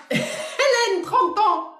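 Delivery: giggly restart. Real, not acted. Medium restarting the reading for Hélène, still giggling. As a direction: try to get serious but still giggling.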